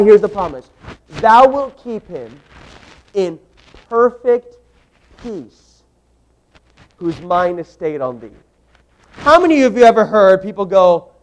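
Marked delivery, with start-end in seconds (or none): 1.2-8.3 slow, hushed scripture reading with long pauses; solemn scripture reading. Acted. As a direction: let each word of the verse hang.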